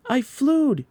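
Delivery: put-on very high, loud child's scream of joy; thrilled scream. A narrator's reading. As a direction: scream with delight midair. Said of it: "he screamed".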